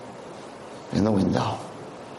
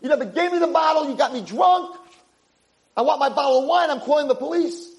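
put-on screaming drunk. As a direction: rage at them like you've been robbed. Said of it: "And he starts screaming".

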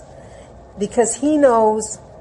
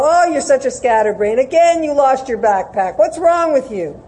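high, loud exasperated scolding; mock angry telling-off, pitch raised; berating parent; 6 semitones higher, 8 dB louder than usual. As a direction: act out a parent laying into a child. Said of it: "whatever it is that we were berated for".

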